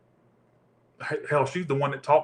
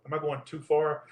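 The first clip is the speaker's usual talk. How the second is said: ordinary talk, nothing marked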